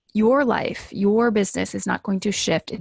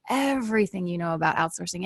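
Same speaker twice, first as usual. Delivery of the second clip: usual speaking voice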